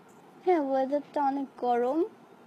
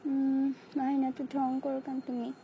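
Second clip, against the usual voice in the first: ordinary delivery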